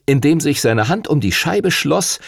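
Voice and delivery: usual speaking voice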